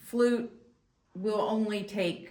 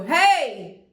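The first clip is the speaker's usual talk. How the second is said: a loud shout of hey with no push from the belly; loud hey with no diaphragm kick; loud call; 7 semitones higher, 12 dB louder than usual. Shown as a demonstration. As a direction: call out to someone far off from the throat. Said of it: "if you go".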